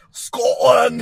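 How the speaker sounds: loud, high Scottish shout; hammed-up Scottish cry; mock Scottish accent. Shown as a demonstration. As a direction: bellow it as a cartoon Scot. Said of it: "Do a Scottish".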